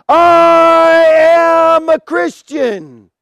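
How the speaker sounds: long drawn-out, high shout; acted martyr's defiant proclamation; bold declaration. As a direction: cry it out to a whole arena, stretching the words. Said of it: "as he's crying out".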